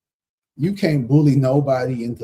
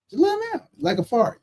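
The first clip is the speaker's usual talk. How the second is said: squeaky comic punchline; 12 semitones higher than usual. Acted. Telling the viewer how to drop people, in a squeaky comic voice. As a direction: pitch jumps way up on the fart line.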